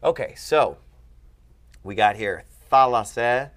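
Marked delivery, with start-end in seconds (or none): 2.7-3.5 thalassa said loudly, THA stressed; stress on first syllable. Shown as a demonstration. Teacher reciting a declension, front-stressed thalassa. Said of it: "boom, the accent's here".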